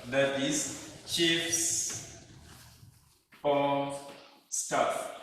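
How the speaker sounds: chiefs slowly stretched, pause before staff; slow demo stressing chiefs, then pause. Shown as a demonstration. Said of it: "a chief of staff".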